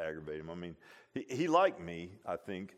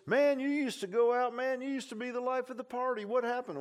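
high, put-on voice of an old party buddy; mocking worldly friend; 12 semitones higher, 4 dB louder than usual. Preacher voicing a puzzled former party friend, high mock voice.

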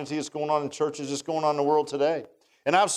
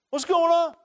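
put-on high boyish voice, a bit louder; wide-eyed boy asking what's up; eager youth calling out the question; young David; 4 dB louder than usual. David's question repeated after aside.

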